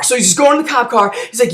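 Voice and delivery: normal talking voice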